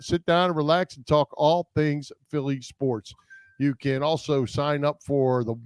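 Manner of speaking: ordinary talk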